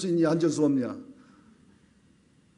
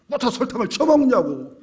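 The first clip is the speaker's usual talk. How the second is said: 쳐먹냐고 thrown out higher and louder, mock scolding; angry wife; 7 semitones higher, 5 dB louder than usual. Wife scolding husband over salt on rice cake, mock angry. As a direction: snap 쳐먹냐고 at the husband, half-acted. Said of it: "성질에서 막 싸웠답니다".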